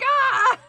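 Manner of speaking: high loud startled shriek; startled scream. Retold or imitated. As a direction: shriek in mock fright. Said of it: "And I'm like".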